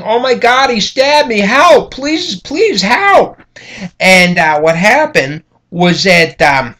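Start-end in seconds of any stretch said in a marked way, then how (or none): 0.0-3.4 high, pleading cry for help, slow at the end; imitating a stabbed woman's frantic plea; retold screaming for help. Teacher retelling the victim's screams for help, high and pleading. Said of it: "she's yelling and she's screaming".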